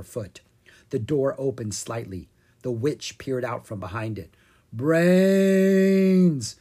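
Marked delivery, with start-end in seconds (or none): 4.7-6.6 loud, long, higher zombie moan; zombie groan. A narrator's reading. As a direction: groan it at the witch's door. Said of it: "Ella groaned".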